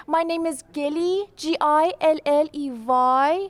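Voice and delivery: put-on slow, drawn-out Iranian-accented spelling; mom's Iranian accent. Daughter mimicking her mom spelling her name, slow Iranian accent. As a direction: imitate an Iranian mother spelling carefully. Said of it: "My mom, whenever she spells her name".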